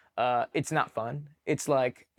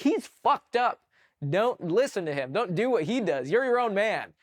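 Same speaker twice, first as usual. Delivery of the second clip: higher, emphatic mock telling-off; retold yelling; 7 semitones higher than usual. Leader yelling at new private not to copy the other, retold yell. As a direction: imitate yelling an order, moderately raised. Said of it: "I, like, yell at him".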